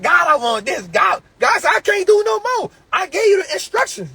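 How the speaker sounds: shouted retelling of a prayer; mock prayer quote. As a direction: yell out the prayer and God's reply. Voices praying believer and God.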